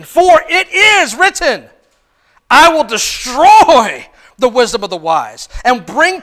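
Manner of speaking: slow, loud declaration; thundering scripture reading; proclaiming scripture. Acted. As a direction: read it like a proclamation. Scripture read at full force.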